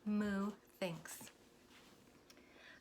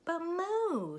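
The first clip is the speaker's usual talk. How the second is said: high, louder objection; doubtful Minnie; 9 semitones higher, 3 dB louder than usual. Acted. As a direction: protest doubtfully. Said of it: "said Minnie".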